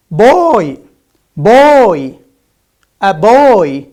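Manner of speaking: loud, emphatic "Boy" with voiced B; punched, stretched "Boy" as a teacher's example; clear B sound. Shown as a demonstration. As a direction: model "Boy" with a firm B, no puff. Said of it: "Yes, it is correct".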